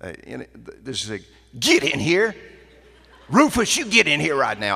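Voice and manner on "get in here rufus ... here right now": loud, high country call out the back door; calling the dog. Retold or imitated. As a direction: holler for the dog like a country store owner. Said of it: "the country store person goes to the back door to call the dog".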